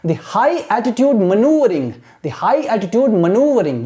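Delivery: very slow, careful reading aloud; teacher reading for imitation; slow model reading. Shown as a demonstration. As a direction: read slowly for students to copy. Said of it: "Exactly you have to imitate".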